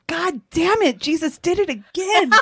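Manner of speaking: high, loud joke punchline; mock exasperated outburst. Woman pulled over with wine blames Jesus, mock exasperated shout. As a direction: cry out in comic outrage as the caught driver. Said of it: "she looks at it and she goes".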